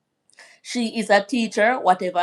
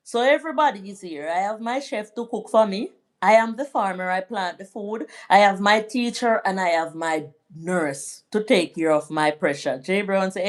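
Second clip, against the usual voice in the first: ordinary talk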